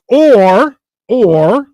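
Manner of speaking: big, high, drawn-out interjection; loud, swooping counter-thought; emphatic self-correction. Acted. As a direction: halt your own train of thought loudly. Interrupting own catastrophic thinking.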